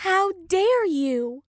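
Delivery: sharp, high, drawn-out hiss of rage; hissed fury. A narrator's reading. Olivia confronting the gallery owner, high angry hiss. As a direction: hiss it at the woman who wronged her sister. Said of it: "hear Olivia hiss".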